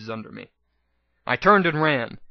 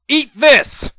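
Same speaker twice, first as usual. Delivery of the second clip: much higher, much louder battle shout; 11 semitones higher, 10 dB louder than usual. Acted. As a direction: hurl it at the beast. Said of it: "I shouted".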